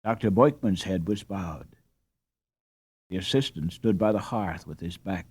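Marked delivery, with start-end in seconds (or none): none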